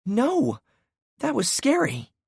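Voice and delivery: high, gasping child, hammed-up fright; dramatic little girl. A narrator's reading. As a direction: play the kid's big drama. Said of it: "she gasped with high drama".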